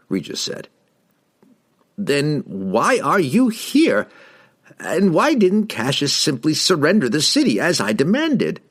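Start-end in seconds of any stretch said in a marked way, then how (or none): 2.0-8.6 higher, imperious questioning; demanding wizard. A narrator's reading. Wizard challenges the halfling, higher and demanding. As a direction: demand an explanation with rising force.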